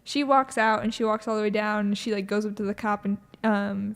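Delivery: ordinary delivery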